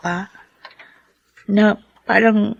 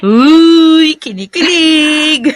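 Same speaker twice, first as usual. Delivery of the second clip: louder, slow sing-song teasing; 6 semitones higher, 6 dB louder, much slower than usual. Acted. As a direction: tease her about blushing. Daughter teases blushing mother. (panunukso, mabagal at pakanta)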